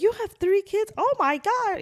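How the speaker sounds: very high-pitched mock astonishment; amazed disbelief. Young girl learning she has three kids, amazed disbelief. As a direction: imitate a stunned, squealing reaction.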